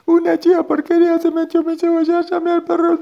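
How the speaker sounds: very high, loud put-on wail; crying grandma. Grandma telling the bull about the goat, high tearful gabble. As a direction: pour it out in a squeaky crying gabble.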